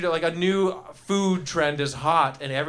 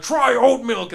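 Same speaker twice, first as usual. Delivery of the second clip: "Try oat milk." higher and louder, hammed-up; louder pushy stranger; 6 semitones higher, 6 dB louder, slower than usual. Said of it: "they're like".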